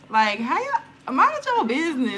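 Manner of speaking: loud, high-pitched indignant outburst. Real, not acted. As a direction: tell them off, pitch climbing. Indignant at YouTube's cussing rule.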